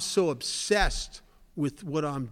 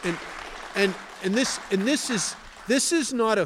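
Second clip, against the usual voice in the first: rising emphatic voice; 5 semitones higher, 4 dB louder than usual. Real, not acted. Preaching point, rising emphasis.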